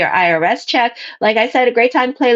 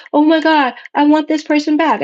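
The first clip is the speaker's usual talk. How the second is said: higher-pitched mock yearning; pining client; 5 semitones higher than usual. Client tells reader they want their ex back, mock yearning. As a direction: voice a client pining for an ex, lightly hammed-up.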